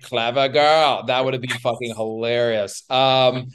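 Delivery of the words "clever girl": slowed, deadpan hunter line; mock movie quote. Imagining saying it to the flanking cougar, slow deadpan quote. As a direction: say it coolly as the cat flanks you. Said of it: "be like".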